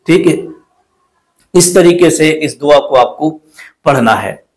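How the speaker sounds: usual speaking voice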